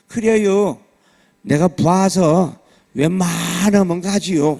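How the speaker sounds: loud, slowly stretched Chungcheong speech; Chungcheong drawl. Shown as a demonstration. Preacher coaching church women's reply, slow Chungcheong drawl. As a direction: draw out the vowels in Chungcheong style. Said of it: "제가 충청도 사람 아니에요?".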